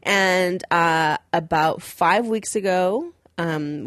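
usual speaking voice

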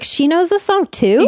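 high, incredulous mock question; mock astonishment, voice lifted; surprised. Imagined reaction of startled customers.

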